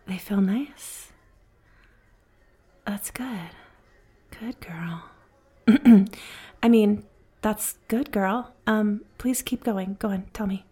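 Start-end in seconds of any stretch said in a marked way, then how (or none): 0.1-5.0 slow, soft whisper close to the ear; sultry whisper. Acted. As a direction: let the praise slip out seductively. Slips into flirting.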